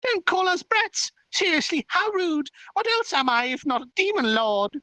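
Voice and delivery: very high, squeaky outrage; offended little fairy, indignant. Acted. Fairy demon lord called a brat, high indignant protest. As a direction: protest shrilly as a tiny self-proclaimed demon lord.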